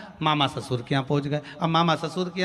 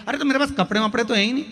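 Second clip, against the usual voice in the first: hurried protest; 6 semitones higher, 4 dB louder, much faster than usual. Acted. Friend hesitates about clothes.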